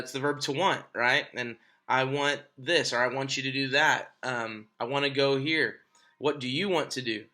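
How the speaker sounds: ordinary talk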